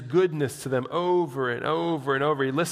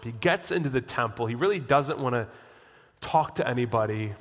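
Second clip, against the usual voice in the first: normal talking voice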